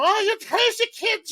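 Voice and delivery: high-pitched mock Irish scolding; horrible Irish accent. Boston boy mimicking neighbors yelling at him, thick Irish accent. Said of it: "they sounded like they got off the boat".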